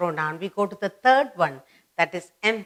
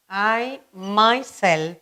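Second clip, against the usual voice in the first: I myself, spoken slowly and evenly for writing down; slow dictation; much slower than usual. Shown as a demonstration. Teacher dictating the example for pupils to copy, slow. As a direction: dictate I myself at half speed.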